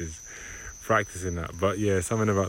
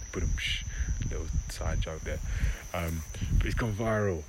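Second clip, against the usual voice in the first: ordinary delivery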